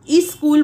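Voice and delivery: स्कूल said as "iskool", with ई in front; added opening vowel. Shown as a demonstration. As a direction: lead into स्कूल with a clear i sound. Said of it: "ई जोड़ दी जाती है".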